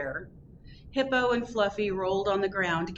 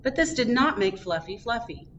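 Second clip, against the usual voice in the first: ordinary delivery, nothing marked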